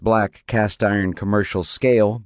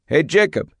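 raised voice calling across to someone; 7 semitones higher than usual. A narrator's reading. Father calling to his son across the paddock, raised voice. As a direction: call his name across the yard. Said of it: "Eagle called".

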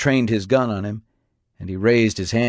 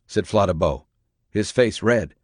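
plain reading voice

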